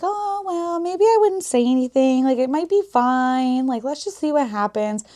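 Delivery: imitating a sheepish husband; mocking husband. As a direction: play him sheepish and dismissive. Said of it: "this man's just sitting there like".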